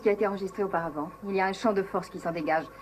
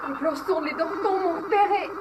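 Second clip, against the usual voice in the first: panicked; 12 semitones higher than usual. Acted. Maya panics, told to calm down.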